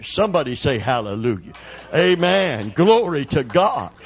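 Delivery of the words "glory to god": ordinary talk, nothing marked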